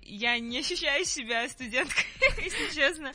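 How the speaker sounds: giggling through the words; amused, half-laughing confession. Real, not acted. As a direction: laugh while admitting it. Singer laughing about feeling like a star.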